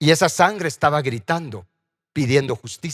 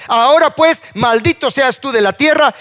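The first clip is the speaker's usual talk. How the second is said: loud, high-pitched pronouncement; thundering judgement; 10 semitones higher, 7 dB louder than usual. Acted. God cursing Cain after his refusal, thundering. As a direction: pronounce the curse with full force. Preacher voices God's curse on Cain.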